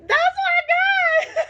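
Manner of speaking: shrieking with laughter, high and loud; a real cackling shout of delight; laughing shout. Real, not acted. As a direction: burst out laughing on it.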